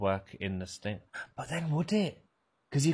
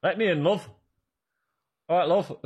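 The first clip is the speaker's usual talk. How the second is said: put-on northern English accent; 7 semitones higher, 6 dB louder than usual. Repeating the northern catchphrase playfully.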